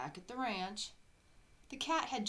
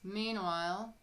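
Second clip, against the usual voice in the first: ordinary delivery, nothing marked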